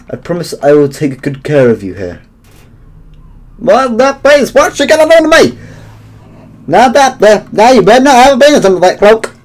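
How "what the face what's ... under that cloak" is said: excited outburst. Real, not acted. Reacting loudly to Toriel.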